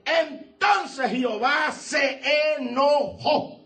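slow shout. Acted. Preached emphasis, slow shout.

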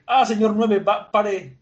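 flustered princess. Acted. Vivi telling Mr. Nine to stop, high flustered voice. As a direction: wave him off, flustered.

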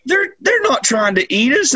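high-pitched imitated cooing, slowing; mock cooing. Family decides the lions are cute, high mock cooing. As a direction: coo in a high voice as if at cute animals.

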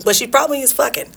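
usual speaking voice

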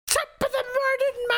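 high Irish YouTuber greeting; Jacksepticeye impression.

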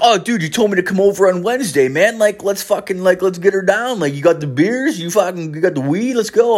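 high, fast, put-on party-dude voice; eager buddy. Old buddy turning up to party, high eager voice. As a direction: rush it out high, raring to go.

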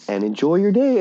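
high, bubbly theme-park greeter voice; cheery cast member. Retold or imitated. Retold Disney worker greeting guests brightly. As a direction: sell the magic, sweet and high. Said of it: "She's like".

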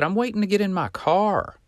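very high mock-indignant voice; retold protest in a high, put-on voice; imitated indignant reply. Old man's indignant answer retold.

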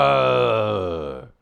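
long loud roar, falling away; imitated thunder roar. Retelling the storm that woke him, imitated roar. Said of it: "There was this".

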